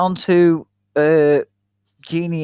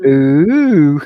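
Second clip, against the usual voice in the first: impressed ooh; 6 dB louder than usual. Retold or imitated. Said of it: "And he went".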